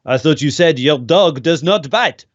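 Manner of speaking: lightly voiced bad French accent; a hint of the comic French inspector; retelling the Pink Panther dog gag in a mock French accent. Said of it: "he puts on this very bad French accent".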